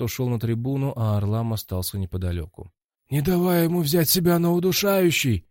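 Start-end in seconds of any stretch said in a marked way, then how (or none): 3.1-5.5 higher, louder coach warning. A narrator's reading. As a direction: warn him like a cornerman. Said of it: "напомнил он".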